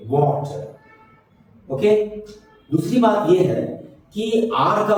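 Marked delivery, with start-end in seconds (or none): 0.1-1.7 "वाटर" drawn out slowly as waw-chuh; slow, clearly sounded "वाटर", waw then chuh; both syllables distinct. Shown as a demonstration. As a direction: stretch "वाटर" so both syllables are heard. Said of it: "ऐसा बोलेंगे कि दोनों सुनाई दे".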